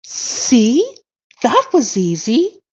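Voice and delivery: high, slow cartoon voice; cartoon character voice. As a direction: sound pleased as the cartoon character. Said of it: "It literally goes like this".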